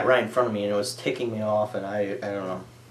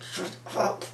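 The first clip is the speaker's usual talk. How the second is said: whispered shut up; 8 dB quieter than usual. Retold or imitated. Girl laughing at boy.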